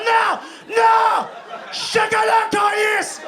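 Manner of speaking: high, loud screaming refusal; toddler tantrum. Acted. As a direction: wail it like a stubborn kid.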